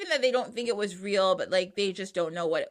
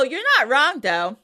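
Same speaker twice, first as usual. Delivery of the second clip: usual speaking voice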